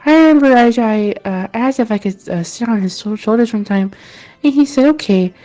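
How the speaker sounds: a hint of a dreamy local; villager voice. Same villager recalling childhood.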